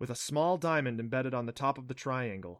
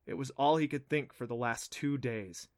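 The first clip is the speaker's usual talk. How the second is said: ordinary delivery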